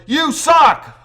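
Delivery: put-on high, loud childish taunt; imitating a loud teasing buddy; mocking taunt. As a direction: belt it out like a schoolyard jeer. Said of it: "he'd reply with".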